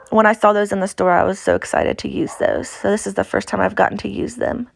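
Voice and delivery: ordinary delivery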